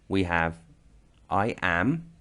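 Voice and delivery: usual speaking voice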